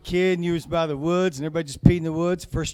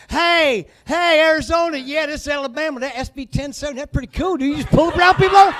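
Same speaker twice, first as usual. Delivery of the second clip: mock good-ol'-boy shouting across states; Alabama dude calling Arizona; 9 semitones higher, 11 dB louder than usual. Alabama calling out to Arizona, loud mock bro. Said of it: "I don't know if you remember this".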